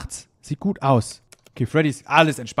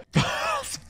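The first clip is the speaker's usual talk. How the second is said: nervous breathy laugh; 7 dB quieter than usual. Real, not acted. Nervous laugh after jumpscare.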